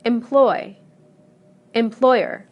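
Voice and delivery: em-PLOY, em-PLOY-er; loud second syllable in Employ and employer; same stress. Shown as a demonstration. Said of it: "the stress remains the same".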